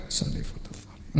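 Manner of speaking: barely audible, voiceless whisper; whispered recitation. Shown as a demonstration. Preacher showing how to say the intention for oneself, whispered. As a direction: murmur the intention under your breath, slowly. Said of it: "Nggak usah keras".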